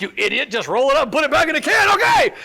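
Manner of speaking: imitating an impatient retort, rising to a yell; mock exasperated shout. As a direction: build to a high, hammed-up shout on the last word. Mimics someone's exasperated reply.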